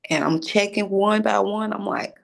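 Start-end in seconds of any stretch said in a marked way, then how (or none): none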